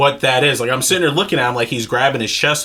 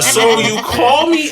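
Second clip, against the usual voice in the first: higher, slow, louder disbelief; incredulous; 8 semitones higher, 4 dB louder, slower than usual. Retold or imitated. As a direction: ask it with mocking disbelief. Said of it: "I'm like".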